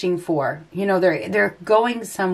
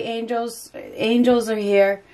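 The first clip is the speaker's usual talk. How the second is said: usual speaking voice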